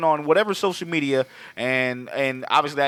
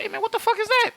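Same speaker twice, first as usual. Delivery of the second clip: very high squeaky mock monster; mock Godzilla voice; faster than usual. S0 voicing Godzilla spotting Kong, very high squeak. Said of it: "Godzilla's like".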